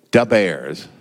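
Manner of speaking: punchy mock Chicago accent; joking Chicago-accent impression. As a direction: say it like a Chicago Bears fan. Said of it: "That was a pretty good Chicago accent".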